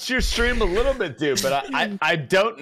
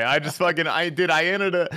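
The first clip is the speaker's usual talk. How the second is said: ordinary delivery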